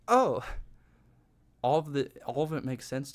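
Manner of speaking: high, loud acted "oh"; sudden realisation. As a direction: burst out as if it all suddenly clicks.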